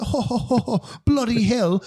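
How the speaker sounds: hammed-up British astonishment; British accent. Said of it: "like, this British accent".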